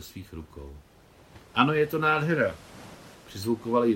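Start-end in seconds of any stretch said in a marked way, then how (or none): 1.5-2.5 eager agreement. A narrator's reading. Joyful agreement in the sea.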